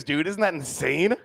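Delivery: ordinary talk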